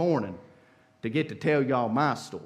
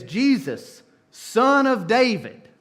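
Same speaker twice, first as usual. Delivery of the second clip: louder, higher, drawn-out call; hollering; 7 semitones higher, 6 dB louder, slower than usual. Retold or imitated. Blind beggar calling to Jesus over the crowd, hollering. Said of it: "and he hollers".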